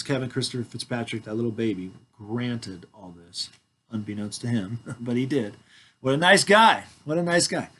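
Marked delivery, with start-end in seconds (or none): 6.0-6.8 louder, ironic praise; mock praise. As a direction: cheer himself on with mock admiration.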